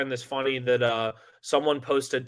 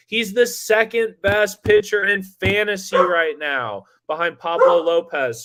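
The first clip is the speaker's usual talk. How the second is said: higher, louder burst of excitement; excited; 6 semitones higher, 5 dB louder than usual. Real, not acted. Co-host gloating to Chuck about a fantasy pick, excited. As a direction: get carried away, voice raised. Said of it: "I got so excited".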